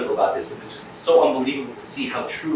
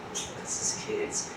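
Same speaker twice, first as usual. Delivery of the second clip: slow, even softer whisper; fainter whisper; 12 semitones lower, 15 dB quieter, much slower than usual. Shown as a demonstration. Teacher repeating the answer in a fading whisper. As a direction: let it fade almost to nothing. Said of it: "repeated softer still".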